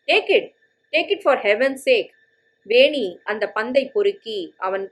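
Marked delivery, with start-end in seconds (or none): 0.1-2.1 impatient urging. Acted. Impatient golfer urging girl.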